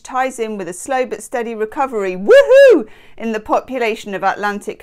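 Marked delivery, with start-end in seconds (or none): 2.2-2.9 excited woohoo. Acted. Celebrating whale recovery.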